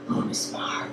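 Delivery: very soft whispered menace; creepy whisper. Retold or imitated. Masked uncle to frightened niece, creepy mock whisper. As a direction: whisper it like the mummy in the hallway. Mummy answers the scared child.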